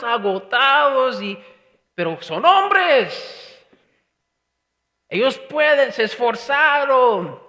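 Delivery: loud, high, fervent preaching; preaching at full force. Acted. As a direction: drive it home to the congregation, slowing on key words. Preaching the storm scene.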